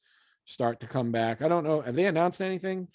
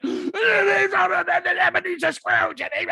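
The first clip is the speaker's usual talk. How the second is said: sudden high gasping cry, voice jumping up; hammed-up shocked gasp as Scrooge; gasping Scrooge outburst; 6 dB louder than usual. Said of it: "he's like".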